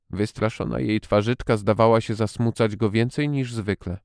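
usual speaking voice

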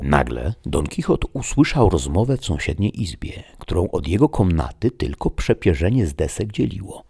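plain reading voice

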